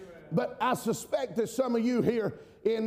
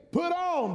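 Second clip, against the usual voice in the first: high, ringing "Put on."; emphatic command; 7 semitones higher than usual. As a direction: hit "Put on." hard and high. Preacher repeats scripture command.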